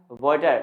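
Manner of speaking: "वाटर" said vo-tar; corrected pronunciation. Shown as a demonstration. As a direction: round the first vowel of "वाटर" to o. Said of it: "क्या होता है?". ("वाटर" को 'वॉटर' बोलें)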